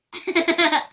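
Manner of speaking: sudden loud burst of laughing; loud laughter. Real, not acted.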